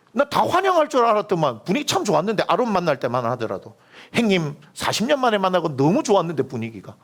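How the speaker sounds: a hammed-up sulky protest to God; whining Moses. Moses griping to God in the preacher's version, high whiny voice. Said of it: "제 버전으로 보니까 그렇다는 거예요".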